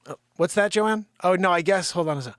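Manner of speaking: ordinary talk, nothing marked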